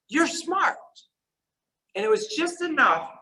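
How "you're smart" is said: gentle reassurance. Retold or imitated. Teacher reassuring Merle, quoted.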